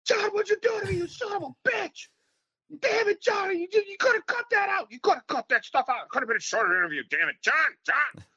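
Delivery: mock-sob a complaint at Johnny; whining rant. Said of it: "I'm like".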